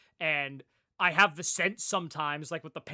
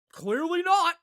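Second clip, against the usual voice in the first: exasperated; 11 semitones higher, 7 dB louder, slower than usual. Real, not acted. Frustrated rant about headphones.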